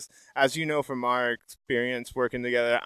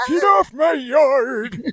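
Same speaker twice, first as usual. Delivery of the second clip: put-on high, slow, loud old man yelling; grumpy old man shout; 11 dB louder, much slower than usual. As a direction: bark like a cranky old-timer.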